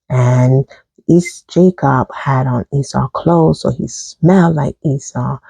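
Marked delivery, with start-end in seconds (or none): none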